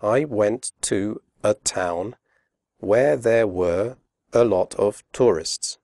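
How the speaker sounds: slow, careful, every word full; slow, clear example read; spoken slowly. Shown as a demonstration. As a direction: pronounce each word separately. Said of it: "Listen to this sentence spoken slowly".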